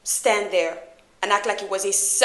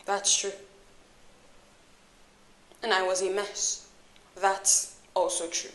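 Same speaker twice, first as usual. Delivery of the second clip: low, soft, held-back confrontation; softly spoken, controlled hurt; quiet reproach; 5 semitones lower, 10 dB quieter than usual. Acted. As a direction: keep the accusation low and quiet. Hurt confrontation, holding anger in.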